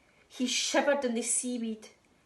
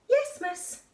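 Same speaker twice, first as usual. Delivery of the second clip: high, louder squeaky pupil reply; little fish pupil; 14 semitones higher, 5 dB louder than usual. Acted. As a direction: pipe up like a tiny eager fish. Pupils answer teacher's register.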